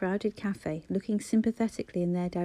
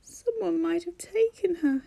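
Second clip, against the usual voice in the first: put-on high, tearful little boy whimper; frightened child near tears; 9 semitones higher than usual. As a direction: whimper it between shaky breaths. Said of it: "he whimpered".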